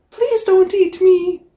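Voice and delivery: high, loud frightened plea; pleading. Acted. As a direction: beg the dragon not to eat her. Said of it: "cries the witch".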